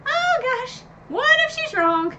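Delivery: high, strained voice of sudden worry; panicked inner thought. Retold or imitated. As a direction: squeak out the fear that she guessed wrong. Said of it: "'cause I was like".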